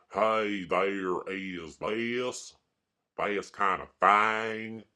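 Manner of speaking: slow, stretched mock Kentucky drawl; Kentucky accent. Mocking what Kentuckians have to say, thick Kentucky accent. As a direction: drag it out in an exaggerated Kentucky twang. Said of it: "But they have to say".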